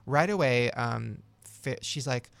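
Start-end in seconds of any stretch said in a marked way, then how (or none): none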